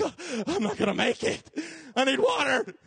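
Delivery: put-on panicking kid. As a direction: freak out, desperate. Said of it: "You're like".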